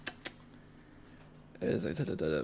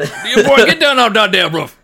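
put-on scolding Southern old-timer; 9 semitones higher, 9 dB louder than usual. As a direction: holler up at a boy like a country elder. Acted Southern elder scolding a boy.

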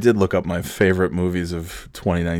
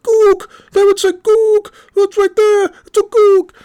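imitated professor screaming, very high and loud; mock screaming; 8 dB louder than usual. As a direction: shriek it as the ranting professor. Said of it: "he starts screaming at the top of his lungs".

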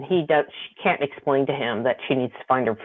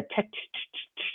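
repeated "ch" noise as a purr; imitated purring sound; 15 dB quieter than usual. Alien trying to calm her, imitated purring noise. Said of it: "verbalized purring".

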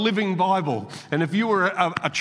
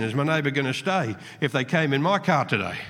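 ordinary talk, nothing marked

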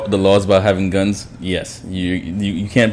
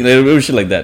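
ordinary delivery

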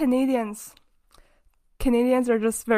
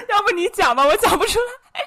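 higher, louder, laughing plea; too giggly to tell; 7 semitones higher, 4 dB louder, faster than usual. Real, not acted. Asking the friend to tell the joke instead, laughing. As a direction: hand the joke over while cracking up.

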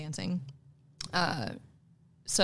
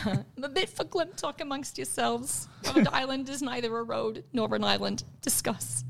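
higher-pitched Brooklyn talk-show host parody; mock-serious Brooklyn host, pitched up; Coffee Talk host; 5 semitones higher than usual. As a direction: do a sketch-show Brooklyn hostess. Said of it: "skit with Mike Myers called Coffee Talk".

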